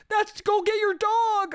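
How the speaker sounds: very high, drawn-out falsetto; mock cheering onlooker. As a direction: urge her on in a silly high voice. Voicing onlookers urging the owner on.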